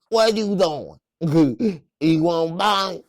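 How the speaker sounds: high, loud mock street hustler; hustler woman voice. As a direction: ham up a pushy liquor-store hustler. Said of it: "it's more like".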